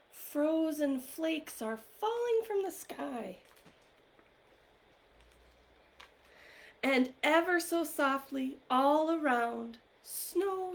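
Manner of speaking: story-time reading voice, bright and eager; reading to children. Acted. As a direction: read it with wonder for little ones. Reads picture book aloud.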